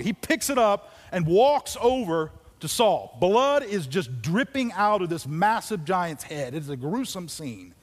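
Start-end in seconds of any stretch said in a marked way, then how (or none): 0.0-6.5 loud, high preacher storytelling; fiery sermon narration; preaching at full force. Acted. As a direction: drive the story home to the congregation.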